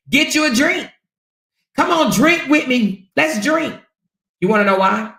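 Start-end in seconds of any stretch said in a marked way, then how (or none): 0.1-3.8 high, sing-out mock coaxing; mock egging on. Imitating commenters calling her to drink, mock coaxing. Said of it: "literally saying".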